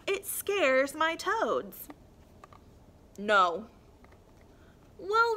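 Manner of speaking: lively storybook voice with figures; reading to children. A narrator's reading. Teacher reads a picture book to young children, lively. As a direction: read it bright for little ones.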